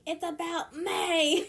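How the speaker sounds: high, slow, hammed-up mom; mock wedding-stealing mom. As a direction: play the attention-grabbing mother, laughing. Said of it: "gonna be that mom at your wedding".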